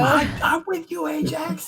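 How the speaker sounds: excited cry; shouting. Pretend. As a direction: yell it out in panic. Panicked cheer at headbutt.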